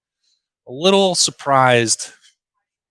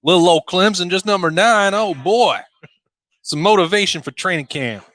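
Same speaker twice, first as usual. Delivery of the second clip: put-on a botched stab at a Southern coach accent, pitched up; failed Dabo accent; 7 semitones higher than usual. Ranking Clemson at nine, mock Southern coach accent. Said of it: "That's not Dabo's accent".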